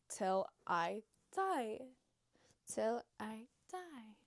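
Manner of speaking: slow, stretched vow; drawn-out vow. Real, not acted. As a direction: stretch it out like a mock oath.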